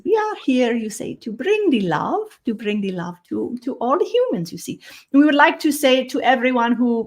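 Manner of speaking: a gentle otherworldly visitor greeting the humans; [name] channeled voice. Acted. Channels a Pleiadian being.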